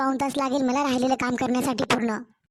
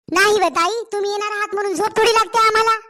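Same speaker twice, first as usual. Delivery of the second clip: higher, louder mock-innocent teasing; teasing jab; 6 semitones higher, 5 dB louder than usual. Daughter-in-law teasing her sister-in-law about sleeping, playful sarcastic jab. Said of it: "तुला आता मजाक पण सहन होईना".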